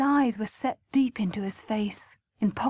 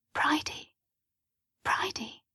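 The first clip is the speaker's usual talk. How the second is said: urgent-to-be-heard church whisper, higher and soft; 6 semitones higher, 5 dB quieter than usual. A narrator's reading. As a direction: whisper her name to get her attention. Whispering to sister during church singing.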